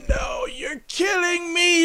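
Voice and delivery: high, shouted, hammed-up melodrama; mock Tommy outburst. Tommy yelling at Lisa over burnt toast, hammed-up high shout.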